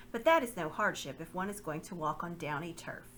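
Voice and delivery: usual speaking voice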